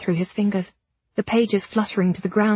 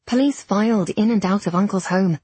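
ordinary delivery

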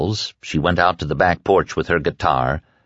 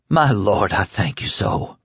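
tearful whisper. A narrator's reading. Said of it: "Tears coursed warmly down her cheeks".

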